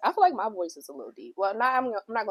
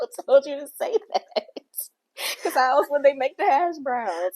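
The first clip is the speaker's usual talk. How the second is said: laughing; 8 semitones higher, 7 dB louder than usual. Real, not acted. Laughing at the friend's wording.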